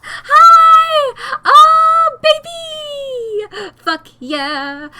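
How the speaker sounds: high, loud squeal of joy; thrilled yelling; delighted shriek. Real, not acted. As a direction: shriek with real delight at the screen. Streamer spots the bats.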